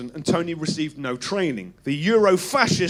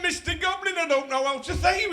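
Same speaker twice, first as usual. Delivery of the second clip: higher, wavering northern English voice; tremulous northern voice; 11 semitones higher, 4 dB quieter than usual. Acted. Northern comic thanking the goblin, high and quavering. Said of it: "my voice was tremulous and northern".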